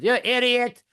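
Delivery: high, loud mock road-rage shout; yelling at drivers. Driver cursing other drivers from his car, high angry shout. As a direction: yell it out the car window. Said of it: "I start yelling at drivers".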